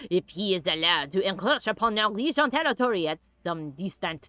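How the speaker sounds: deliberately bad French accent. Streamer reading a letter badly on purpose, French accent. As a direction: read it in a hammed French accent. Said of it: "I read it badly on purpose".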